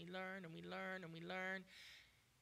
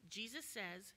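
ordinary delivery

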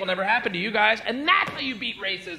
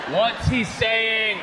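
loud, very slow question; drawn-out, pressing demand; insistent question; 3 semitones higher, 5 dB louder, much slower than usual. Acted. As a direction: stretch each word out to get through.

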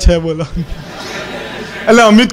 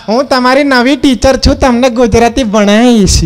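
lightly voiced sweet teacher; 3 semitones higher than usual. Teacher introduced in story.

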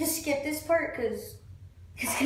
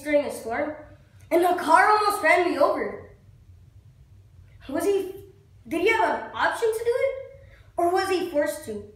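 acted accusation; 2 semitones higher than usual. Actor blames God for nearly getting him killed, agitated accusation. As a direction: confront God with what was done to you.

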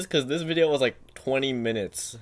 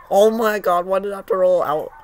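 dismayed; 7 semitones higher, 7 dB louder than usual. Real, not acted. Reacting to rim out.

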